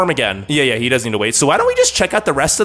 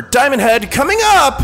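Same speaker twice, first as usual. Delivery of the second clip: mock announcer; 8 semitones higher, slower than usual. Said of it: "D-, do him".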